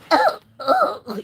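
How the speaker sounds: prim shock. Retold or imitated. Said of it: "those uptight people".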